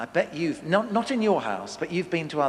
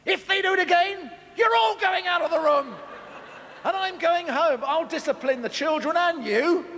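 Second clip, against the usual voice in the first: much higher, hammed-up exasperated outburst; exasperated guest; 12 semitones higher than usual. Preacher as dinner guest losing patience, hammed-up. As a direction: blow up at the whole table as the fed-up guest. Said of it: "I feel like saying".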